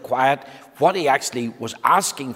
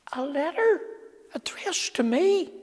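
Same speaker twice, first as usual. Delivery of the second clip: high, wondering little voice. Acted. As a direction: marvel at it in a small voice. Mister Quiet surprised at letter.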